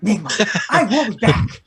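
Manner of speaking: high, pompous villain building to a shout; Penguin. Retold or imitated. Penguin threatening Nigma, building to high shout. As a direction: vow revenge with mounting mock fury. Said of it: "that's all Penguins say".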